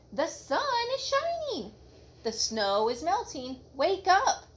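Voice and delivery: high, bright-eyed urging; eager call. A narrator's reading. Frog urging Toad to wake, eager. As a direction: coax a sleepy friend outside. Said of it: "cried Frog".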